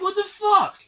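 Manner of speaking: high-pitched, loud yell of disbelief; outraged yell. Retold or imitated. Storyteller re-enacts reacting to the kid, outraged yell. Retelling own outraged outburst.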